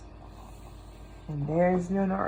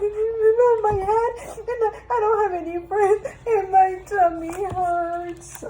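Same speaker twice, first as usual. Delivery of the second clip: put-on high, tearful little boy's wail; upset kid complaining between sobs; crying child; 10 semitones higher than usual. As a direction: blurt out the whole sad story through tears. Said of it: "Until the little boy cried".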